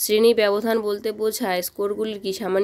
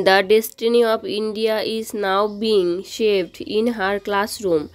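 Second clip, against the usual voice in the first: slow quote reading; much slower than usual. Shown as a demonstration. Teacher reads English quote slowly.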